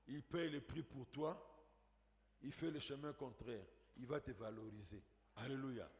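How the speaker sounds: low, hushed preacher voice; soft, earnest preaching. Real, not acted. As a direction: bring it down and reassure the listener quietly. Preacher softens to reassure listener.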